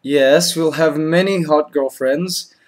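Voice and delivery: normal talking voice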